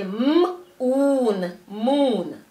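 held "m", long "oon", then "moon" joined; sounding out and blending. Shown as a demonstration. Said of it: "give the oo sound".

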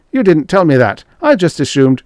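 high, loud protest; agitated defence. A narrator's reading. Grayson defending his assumption to Stark, agitated. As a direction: push the blame away anxiously.

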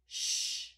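"sh" as a loud, held rush of air; long sh sound. Shown as a demonstration. Teacher offering S H as choice, long "sh". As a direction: hold "sh" without voice. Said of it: "is it S H ship".

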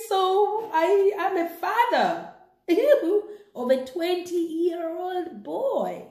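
father laughing and near tears at news of his grown son; overjoyed laughing. Acted. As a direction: burst with joy that he's a father. And this man was so overjoyed.

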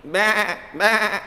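lightly voiced sheep bleating. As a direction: bleat it like a little lamb. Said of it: "grows up saying".

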